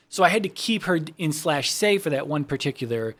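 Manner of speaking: normal talking voice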